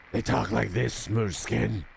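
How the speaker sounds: raspy ghoul voice, lightly done; a hint of a Fallout ghoul growl; ghoul voice imitation. Shown as a demonstration. Streamer imitating how ghouls talk, lightly raspy.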